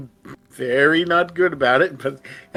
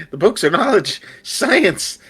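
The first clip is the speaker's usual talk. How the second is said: rising frantic cry, louder; frantic excitement; 14 semitones higher, 7 dB louder than usual. Acted. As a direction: shout it like a book-crazed zealot. Character frantically defends books.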